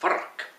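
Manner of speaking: frrrk with a soft rolled Scottish R; frrrk, R trilled like kirk. Shown as a demonstration. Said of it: "Like a little bit Scottish".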